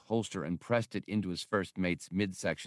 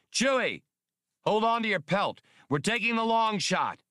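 much higher shouted call to the co-pilot; screaming; 12 semitones higher, 3 dB louder than usual. Acted. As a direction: yell it back over the shoulder. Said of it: "screamed back over his shoulder".

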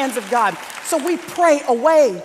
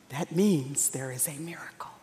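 very low, near-whispered revelation; hushed emphasis; 12 semitones lower, 12 dB quieter than usual. Real, not acted. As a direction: drop right down to an almost whisper. Preacher drops to a hushed punchline.

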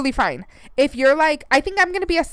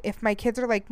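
usual speaking voice

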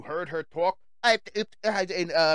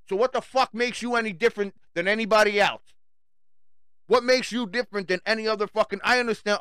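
ordinary delivery

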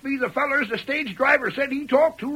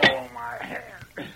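pained cry; 2 semitones lower, 7 dB quieter, slower than usual. Acted. Sheriff shot in the hand.

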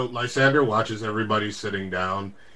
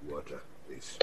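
low and much softer, flat request; quiet order; 10 semitones lower, 18 dB quieter than usual. Acted. Lysander ordering water from the server, low and soft. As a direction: murmur it, keeping it short. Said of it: "and he goes".

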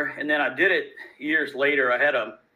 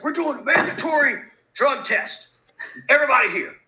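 stern corporate lady; 10 semitones higher, 4 dB louder than usual. Retold or imitated. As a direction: lay down the rule like management. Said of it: "She's like".

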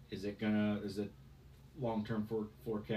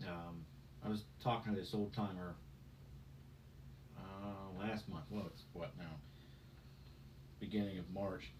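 normal talking voice

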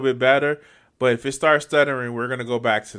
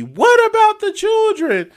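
much higher, louder, slow mock wail; mocking pearl-clutcher; 9 dB louder, slower than usual. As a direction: ham up a fake worried plea to mock him. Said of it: "part of his grift now to be".

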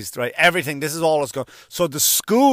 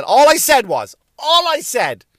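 high-pitched, loud protest of innocence; defensive protest; 10 semitones higher, 7 dB louder than usual. Acted. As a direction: plead your case, voice jumping up high. Protesting innocence over his remark.

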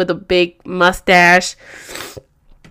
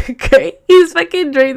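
laughing; 8 semitones higher, 5 dB louder than usual. Real, not acted. Laughing at husband drinking milk.

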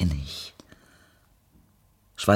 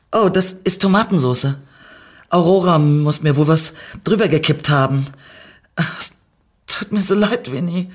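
sobbing; 7 semitones higher, 6 dB louder than usual. Acted. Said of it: "schluchzte sie".

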